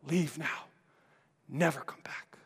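breathy whispered command; a hushed fierce order; shout told, whispered. Acted. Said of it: "and then he shouts".

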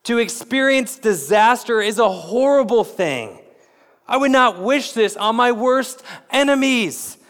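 high, loud, imploring prayer; pleading cry. Pretend. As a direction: cry out to God in sudden understanding. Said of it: "that he would cry out".

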